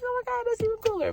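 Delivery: mock high excited gush, quick; mock high excited strangers praising her hat; retold compliment. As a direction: imitate the strangers squealing. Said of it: "And they're like".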